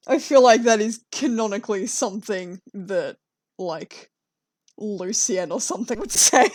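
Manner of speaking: normal talking voice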